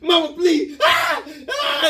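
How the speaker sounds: high loud screaming plea; hammed-up yelp of pain; mock scream. Boy screaming under his mom's belt, loud and high. Screaming while being whipped.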